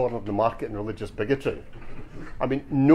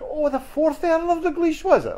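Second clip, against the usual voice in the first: feigned realisation. Acted. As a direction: play along with exaggerated high-pitched awe. Harry feigns being impressed, playing along.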